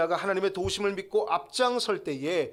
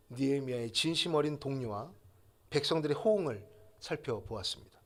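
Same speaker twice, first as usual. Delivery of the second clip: ordinary talk, nothing marked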